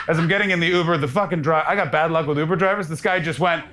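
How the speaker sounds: ordinary delivery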